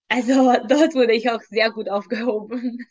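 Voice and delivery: speaking through a light embarrassed laugh; grateful words half laughed out. Real, not acted. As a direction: let a small laugh break into the line. Laughing while thanking mentor.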